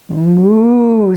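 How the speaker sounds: a drawn-out mooing cow; cow's lowing moo. Acted. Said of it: "said Cow".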